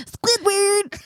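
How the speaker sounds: high cartoon Squidward voice. Shown as a demonstration. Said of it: "Like that. That's how he talks".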